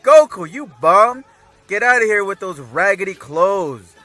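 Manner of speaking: loud, high-pitched mock telling-off; scolding wife. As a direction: yell at a good-for-nothing husband. Chi-Chi scolding her husband.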